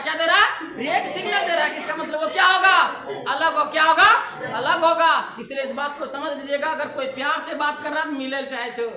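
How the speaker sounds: ordinary talk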